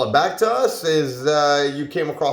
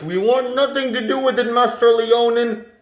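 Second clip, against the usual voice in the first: muffled, higher and fast, as through a hand; hand over mouth; 7 semitones higher than usual. Acted. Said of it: "Muffled voice".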